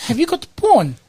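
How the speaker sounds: customer asking. Retold or imitated. Woman at the counter asking the clerk, high. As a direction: ask it high and earnestly. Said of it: "one more time".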